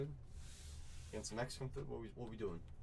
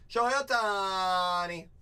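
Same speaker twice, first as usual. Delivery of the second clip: slow, louder drawn-out name; hype name call; 2 semitones higher, 4 dB louder, slower than usual. Real, not acted. Breaker hyping a star card to viewers, drawn-out call. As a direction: savour the star's name.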